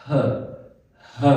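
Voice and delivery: breathy isolated "ह" said twice; sounding out h. Shown as a demonstration. Teacher demonstrating the "ह" sound. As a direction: breathe out a short "ह" two times. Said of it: "हम बोलते हैं".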